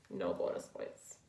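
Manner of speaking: breathy whispered aside; whispering. Acted. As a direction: drop to a joking whisper. Joking repeat, whispered second time.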